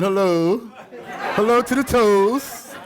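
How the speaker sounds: high, slow sing-song hello; lightly hamming up a cheery greeting to the exercising ladies; mayor's exercise call. Retold or imitated. To ladies exercising, the mayor calls in a high sing-song. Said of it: "he was just saying".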